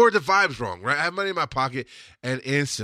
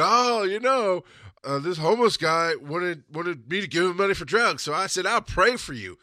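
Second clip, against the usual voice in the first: mock sanctimony; 5 semitones higher than usual. Churchgoer excusing a refusal to the congregation, mock sanctimony. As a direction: mimic a judgmental preacher's excuse. Mocking judgmental preachers and church women.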